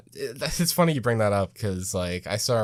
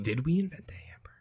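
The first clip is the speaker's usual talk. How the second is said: whispered aside; 5 semitones higher, 6 dB quieter, faster than usual. Real, not acted. Aside while searching.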